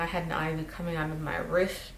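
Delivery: ordinary delivery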